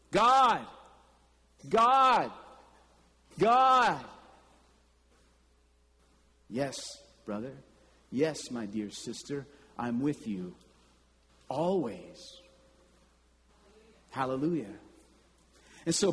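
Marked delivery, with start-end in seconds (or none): 0.1-4.0 louder, higher calls of God; a person crying out to God for notice, loud. Acted. Voiced cry to God. 6.5-14.7 softer, lower reassuring voice; God answering his children, soft and reassuring; God's tender answer. Acted.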